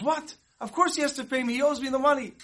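sudden high, indignant protest at the rabbi; angry outburst. Retold or imitated. Butcher protests the rabbi's ruling, angry high outburst. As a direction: protest like the money's being stolen. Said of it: "he got so angry".

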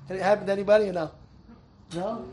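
ordinary talk

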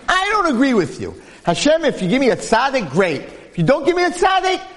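a wife firmly refusing her husband's prayer; heated protest. Acted. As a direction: Rivka arguing with Yitzchak, pitched high and pushing hard. Rivka argues back with Yitzchak.

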